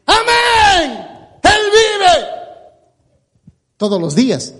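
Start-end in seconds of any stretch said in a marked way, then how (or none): 0.0-2.3 high, slow, ecstatic praise shout; joyful shouting. Retold or imitated. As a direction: shout praise to heaven at full voice. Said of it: "todo el tiempo va a decir". (gritando alabanzas con júbilo, agudo y lento)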